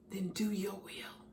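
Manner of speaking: soft, breathy whisper of giving in; whispered surrender. A narrator's reading. Bamboo yielding to the master in a whisper. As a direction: let the words fall to a whisper. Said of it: "and he whispered".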